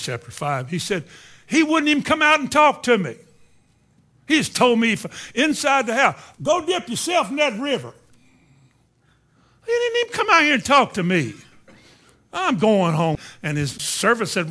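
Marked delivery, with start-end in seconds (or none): 1.5-6.2 loud, high-pitched acted outrage; indignant complaint. Naaman offended by the prophet's snub. 6.4-7.9 high, mocking repeat of an order; scornful mimicry. Naaman scornfully repeats the instruction. 9.7-13.2 high, loud indignant protest; offended huff. Retold or imitated. Naaman offended, storms off.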